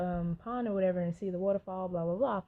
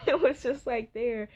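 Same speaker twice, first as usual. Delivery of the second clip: louder, laughing; 4 semitones higher, 5 dB louder than usual. Real, not acted. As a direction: chuckle through it. Laughing about not inhaling.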